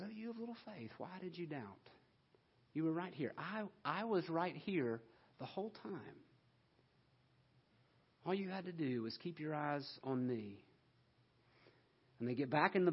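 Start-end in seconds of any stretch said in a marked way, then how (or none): 0.0-10.6 much softer, tender reassurance; gentle Jesus. Acted. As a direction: lift him up with soft, kind words. Jesus gently rescuing Peter.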